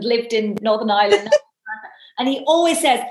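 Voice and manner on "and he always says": normal talking voice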